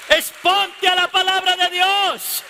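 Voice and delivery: high, forceful exhortation; preaching shout. Real, not acted. Preacher urging people toward God's word, loud preaching cry. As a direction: shout it out to the congregation.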